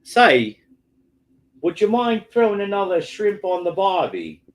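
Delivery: put-on Aussie accent. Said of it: "your Aussie accent is rubbish".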